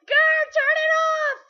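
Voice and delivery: high, loud pained shrieks; agonized yells; screaming in pain. Acted. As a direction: scream as the machine burns you. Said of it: "Zim starts screaming in pain".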